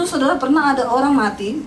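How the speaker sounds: ordinary delivery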